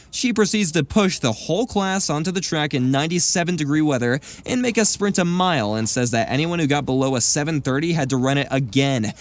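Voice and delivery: plain reading voice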